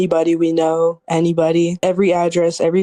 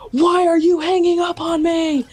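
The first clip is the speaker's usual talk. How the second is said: retold scream; 9 semitones higher than usual. Angry boyfriend screaming over the phone at her, high shout. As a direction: yell it like an angry boyfriend being hung up on. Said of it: "he screams at the top of his lu".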